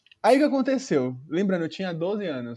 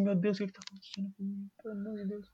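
despairing murmur; 10 dB quieter, faster than usual. Retold or imitated. Panicked boy, retold inner plea.

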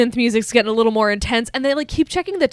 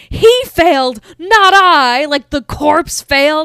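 higher and louder, haughty denial; indignant retort; 6 semitones higher, 8 dB louder, slower than usual. Retold or imitated. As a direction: fling the blame back, loud and proud. Herbert's indignant retort, imitated.

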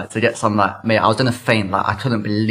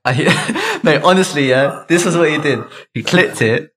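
laughing; 6 semitones higher than usual. Real, not acted. Recalling the technician scene, laughing hard. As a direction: laugh it out, can't believe it.

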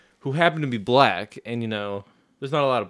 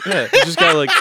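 ordinary talk

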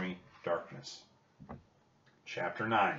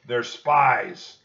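loud, higher major's shout; shouted accusation; 4 semitones higher, 12 dB louder than usual. A narrator's reading. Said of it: "the major shouted".

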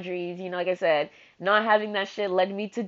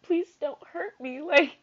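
high, tearful plea; on the verge of crying; 8 semitones higher, slower than usual. Real, not acted. Scared person begging not to be hurt, tearful. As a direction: plead while holding back real tears. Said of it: "I'm gonna start crying again".

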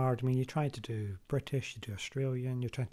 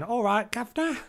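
high, loud mock Cockney greeting; exaggerated Cockney impression; Cockney accent; 10 semitones higher, 7 dB louder than usual. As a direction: call it out in cartoon Cockney. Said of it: "she's a right Cockney lass".

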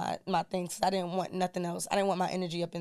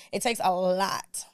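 ordinary talk, nothing marked

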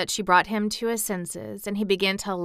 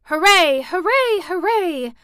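high, loud hoorays; shouted cheer; 11 semitones higher, 9 dB louder, slower than usual. A narrator's reading. Colin imagined rejoicing at the news, shouted cheer. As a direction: cheer triumphantly, pitched far up. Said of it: "Colin would probably have shouted".

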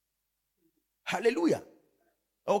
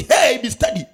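louder mock call to steady yourself; retold loud warning; 10 dB louder than usual. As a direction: call it out to your own mind. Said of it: "I say".

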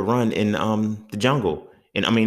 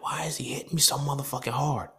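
whispered inner thought; 7 semitones higher, 7 dB quieter than usual. Retold or imitated. Inner thought while being hit.

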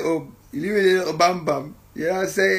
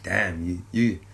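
hushed amazed; 8 semitones lower, 11 dB quieter than usual. Retold or imitated. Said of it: "I'm like".

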